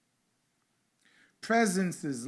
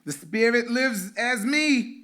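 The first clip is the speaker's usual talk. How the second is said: loud, high call for the crowd to echo; 9 semitones higher, 11 dB louder than usual. Acted. Pastor calling out the affirmation for the congregation to repeat, loud. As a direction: proclaim it to the room. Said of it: "I'm gonna say".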